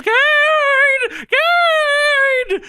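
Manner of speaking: high falsetto wail, drawn out; Paul Bearer. Acted. Wrestling manager calls for Kane, high wailing falsetto. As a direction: call out in a high, loud cartoon squeal. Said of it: "Paul Bearer says".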